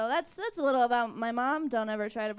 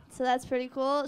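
ordinary talk, nothing marked

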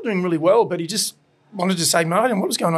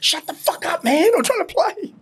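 annoyed guitarist; 11 semitones higher than usual. Retold or imitated. Guitarist snapping back mid-song.

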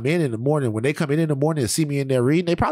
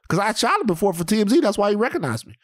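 ordinary talk, nothing marked

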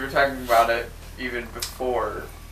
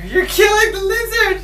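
shrill cry, pitch way up; high-pitched shout; 7 dB louder than usual. Pretend. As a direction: yell it, panicked and high. Cries out over toy lizard.

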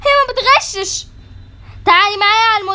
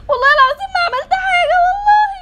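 high, sobbing plea of innocence; crying denial; 7 semitones higher than usual. Acted. Accused schoolgirl sobbing to her teacher. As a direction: beg through tears that you did nothing.

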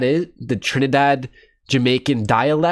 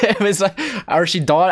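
laughing; 8 semitones higher than usual. Real, not acted. Laughs recalling funny clip.

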